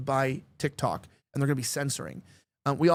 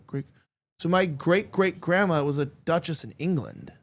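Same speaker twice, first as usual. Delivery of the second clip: usual speaking voice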